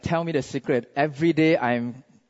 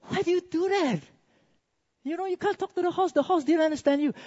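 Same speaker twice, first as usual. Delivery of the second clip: high, softened, rushed protest; mock-horrified disbelief in a thin high pitch; horrified husband; 12 semitones higher than usual. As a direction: plead in shock with the wife. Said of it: "The husband was horrified".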